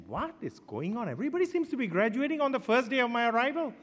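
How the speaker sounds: high, fast disbelieving outburst; half-laughing "what is going on" at the bad luck; incredulous. New student to departing senior, high fast incredulous. Exasperated at everyone graduating.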